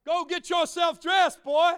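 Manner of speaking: shouted high and loud at the crowd; preaching shout. Real, not acted. Pastor shouting to his congregation, a fiery preaching shout. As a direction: call it out like a revival preacher.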